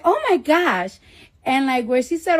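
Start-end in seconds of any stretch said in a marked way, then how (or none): 0.0-1.0 high, slow put-on Staten Island girl voice; mock the doctor's Staten Island girl voice.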